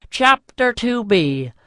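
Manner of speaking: usual speaking voice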